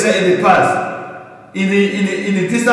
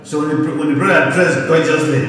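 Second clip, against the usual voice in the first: usual speaking voice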